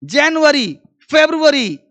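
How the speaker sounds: जनवरी, फरवरी, said "jen-wari, feb-ruwari"; English pronunciation. Shown as a demonstration. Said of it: "tone अपने आप करो". (जनवरी, फरवरी, अंग्रेज़ी ढंग से)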